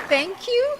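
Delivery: sarcastic, high and sweet; sarcastic high thank you. Pretend. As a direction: chirp it with fake politeness. Sarcastic reply to rude commenter.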